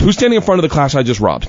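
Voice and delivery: normal talking voice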